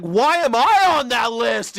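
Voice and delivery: a doomed man begging, hammed up; panicked protest. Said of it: "He's, like, screaming".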